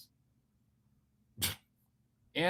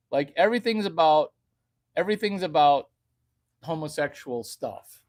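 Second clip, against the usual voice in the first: ordinary talk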